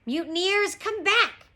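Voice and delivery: high, forceful captain's yell; shout. A narrator's reading. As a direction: yell after the deserting crew. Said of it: "shouted Captain Bones".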